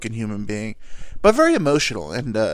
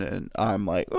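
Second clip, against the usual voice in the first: ordinary delivery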